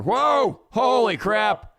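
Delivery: a loud high startled cry. Real, not acted. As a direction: jump at a sudden surprise. Startled by something on screen.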